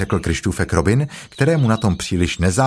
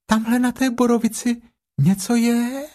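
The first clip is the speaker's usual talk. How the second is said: very high, small piglet voice; high-pitched little animal; 13 semitones higher than usual. Acted. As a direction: squeak it out like a tiny piglet. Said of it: "řeklo najednou prasátko".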